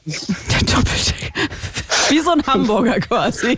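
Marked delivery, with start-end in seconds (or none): 0.0-1.3 breathy giggle on Doppeldecker, slow; laughing whisper. Real, not acted. Laughing echo of a joke.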